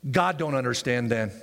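normal talking voice

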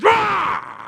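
very high, loud bear roar; angry bear roar. Retold or imitated. Grandparent whose grandkid is threatened, roaring like a bear. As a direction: roar like a mama bear protecting cubs. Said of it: "you get an angry bear".

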